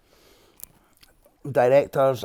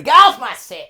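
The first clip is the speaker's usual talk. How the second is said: loud, high mock yell; angry shout; 10 semitones higher, 11 dB louder than usual. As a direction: throw him off set with a shout. Imagined star yelling at him.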